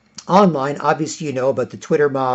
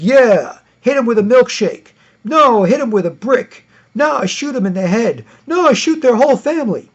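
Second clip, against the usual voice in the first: high, louder and louder mock bloodlust; mock mob egging each other on; escalating mock mob; 7 semitones higher, 5 dB louder than usual. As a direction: build from milkshake to shooting, hammed.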